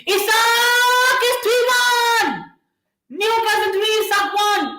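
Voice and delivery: overjoyed goal call, held high; goal celebration shout. Real, not acted. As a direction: scream the score like the ball just hit the net. Goal celebration on live stream.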